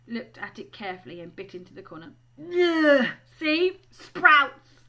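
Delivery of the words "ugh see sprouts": loud, high "ugh" of revulsion; disgusted. Acted. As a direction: spit out the words in loud disgust. Ron tastes a nasty bean.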